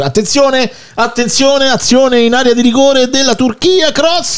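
high, excited play-by-play call; sports commentator. Pretend. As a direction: call the action like a live TV commentator. Mock live match commentary.

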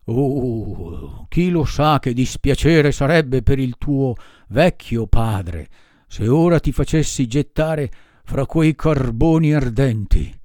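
big gruff puppeteer melting with mock pity; gruff puppet master, self-pitying. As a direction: drag it out like a teary ogre. Mangiafuoco voice held.

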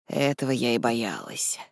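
low, soft admission; quiet. A narrator's reading. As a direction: say it quietly, dropped well below normal pitch. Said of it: "тихо сказала мисс Кайтли".

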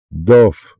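loud narrated call of a name; crying out. A narrator's reading. Karen calls to Dov through the cell peephole, crying out. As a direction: call out sharply to someone in another cell. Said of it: "закричала Карен".

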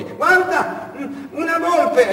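very high, slow delighted cry; a tourist wife spotting a fox in the desert; excited wife. Acted. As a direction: point it out like a thrilled tourist. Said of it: "la moglie".

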